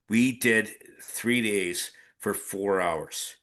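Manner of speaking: normal talking voice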